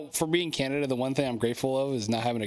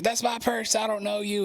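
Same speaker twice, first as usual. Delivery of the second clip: Bobby Hill quote; 8 semitones higher than usual. Retold or imitated. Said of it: "He's like".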